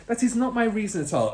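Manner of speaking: high, fast insistent teacher; teacher protesting her motives; urgent denial. Acted. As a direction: deny it urgently. Miss Honey voiced.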